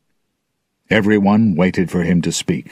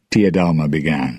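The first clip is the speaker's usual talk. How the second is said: ordinary delivery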